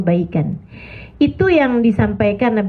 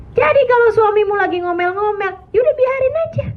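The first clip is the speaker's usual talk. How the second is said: high emphatic advice; 11 semitones higher than usual. Real, not acted. Advising wives, voice rises high.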